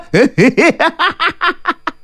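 high, rising triumphant cackle; villain's cackle. A narrator's reading. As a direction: cackle in staccato bursts climbing high. Villain gloats after his threat.

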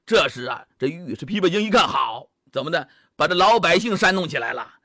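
ordinary delivery, nothing marked

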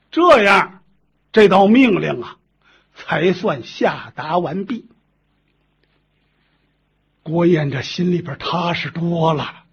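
plain reading voice

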